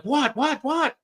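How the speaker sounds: very high repeated what; baffled. Retold or imitated. Caught off guard by his friend's curse, very high. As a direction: pitch the confusion way up.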